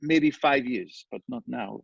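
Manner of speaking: light imitation of a cute Haitian French accent; a touch of Haitian accent, gentle and polite. Landlord imitating a Haitian buyer asking the price, lightly Haitian. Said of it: "cutest little Haitian French accent".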